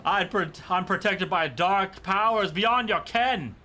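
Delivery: high, theatrical boast; pompous sorcerer. Acted. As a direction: declaim dark powers grandly. Would-be sorcerer boasts, then is mocked.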